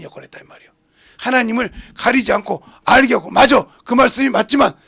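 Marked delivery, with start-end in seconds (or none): 1.2-4.8 loud, raised sermon delivery; forceful preaching. Acted. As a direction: drive the point home to the congregation. (힘주어 외치는 설교)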